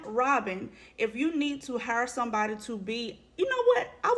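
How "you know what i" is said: sudden squeaky you know what; high exclamation. Real, not acted. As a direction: jump up high in pitch.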